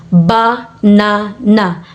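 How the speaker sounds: banana broken into three slow, separate beats; syllable by syllable. Shown as a demonstration. Teacher counting out three syllables, syllable by syllable. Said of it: "three syllables".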